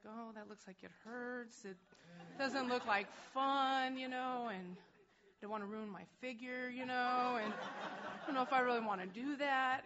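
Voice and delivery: put-on a young woman dismissing motherhood with a laugh; mock whiny reluctance. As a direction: play your younger self brushing it off. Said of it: "I was like".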